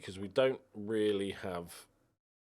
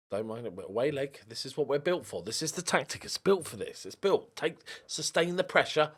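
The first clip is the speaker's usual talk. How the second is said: ordinary talk, nothing marked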